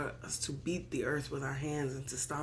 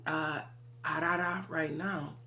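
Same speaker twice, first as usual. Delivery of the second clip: ordinary talk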